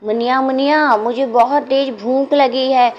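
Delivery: put-on a hungry little boy begging; child's plea. A narrator's reading. Hungry child begs Muniya for food, in a high pleading voice. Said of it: "बोलता है कि".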